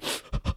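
quick shocked gasp; sharp gasp. Retold or imitated. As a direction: gasp in surprise. Emotional movie moment recalled.

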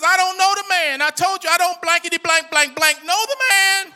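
loud, high angry outburst; acted furious denial, censored swearing at full volume; cussing denial. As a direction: shout it like a man cursing his accusers. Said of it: "He starts cussing".

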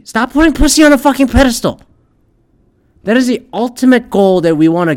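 high, loud and fast outburst; ranting yell. Real, not acted. Ranting vlogger yelling at viewers, high and fast.

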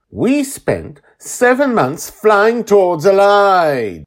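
high, loud, drawn-out accusing yell; furious bellow. A narrator's reading. Joy confronts the Guardian over his lie, furious bellow. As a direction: bellow it right in his face. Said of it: "Joy bellows right at the Guardian's face".

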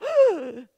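loud high mock gasp; shocked gasp. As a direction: gasp like a scandalized churchgoer.

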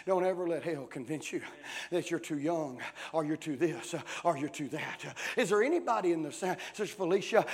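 ordinary talk, nothing marked

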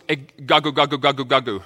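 "gagu" repeated as a grumbling sound, g-a-g-u; onomatopoeic grumble. Shown as a demonstration. Pastor demonstrating the Greek word, grumbling "gagu". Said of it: "it sounds like the word".

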